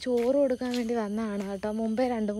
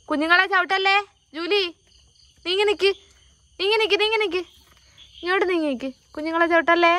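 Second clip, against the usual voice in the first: urgent shooing; 6 semitones higher, faster than usual. Real, not acted. Shooing dog off puppies.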